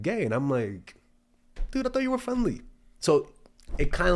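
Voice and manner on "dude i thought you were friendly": very high, wounded voice; high-pitched comic letdown; mock hurt. Voicing his hurt reply to sauna guy.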